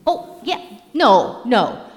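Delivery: high, loud, excited Donkey chatter; imitating Donkey. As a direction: play the babbling, eager sidekick donkey. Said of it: "To which Donkey says".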